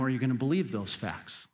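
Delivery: normal talking voice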